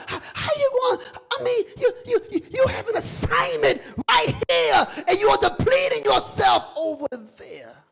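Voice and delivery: very high, strained preacher's cry; crying-out preaching. Real, not acted. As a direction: cry out the charge to them. Preacher cries out.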